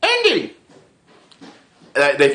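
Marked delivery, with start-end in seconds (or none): 0.0-0.5 very high yelled call; Short Round shout. Retold or imitated. Imitating Short Round calling for Indy with a very high yell. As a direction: call out like the kid in the movie.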